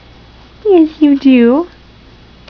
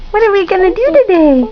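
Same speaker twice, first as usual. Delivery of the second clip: bright cooing question, pitched up; 6 semitones higher, faster than usual. Real, not acted. Mother cooing at her baby son, pitched up.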